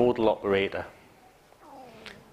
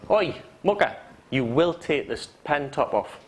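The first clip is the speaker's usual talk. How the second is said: command. Shown as a demonstration. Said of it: "One's a command".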